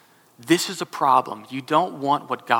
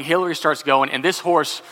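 ordinary talk

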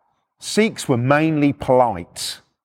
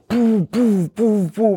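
higher booming thud noises; imitated door banging; 6 semitones higher, much slower than usual. Copper went up to the door, imitated pounding. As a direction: punch out repeated thumps vocally.

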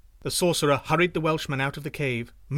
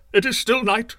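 higher, louder urgent shout; 7 semitones higher, 7 dB louder than usual. A narrator's reading. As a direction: shout it fast to stop them waking. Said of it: "shouted the sorcerer".

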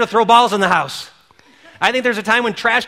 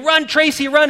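higher, cheering call across a ball field; crowd yelling; 7 semitones higher than usual. Retold or imitated. Parents on both sides screaming for Tracy, cheering call. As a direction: yell encouragement to the little girl. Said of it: "They would be screaming".

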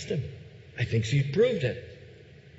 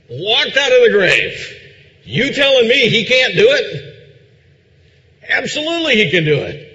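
building preaching; 2 semitones higher, 6 dB louder than usual. Acted. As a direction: start conversational, build to a loud proclamation.

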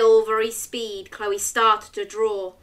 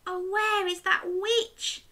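put-on little girl voice; 10 semitones higher than usual. Chloe sneaks looking.

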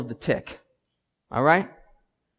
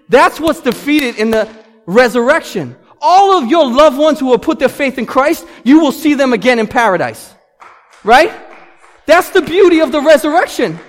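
much louder, higher, rousing sermon voice; full-force preaching; 8 semitones higher, 11 dB louder than usual. Acted. Pastor rallying believers about the resurrection: fiery preaching. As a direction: drive it home to the congregation.

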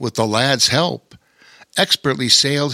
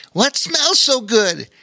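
friendly sailor voice; 11 semitones higher, slower than usual. Acted. Bobo Chico voice.

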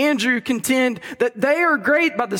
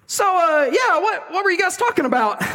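casual question; 8 semitones higher than usual. Acted. Jesus asks disciples, voiced.

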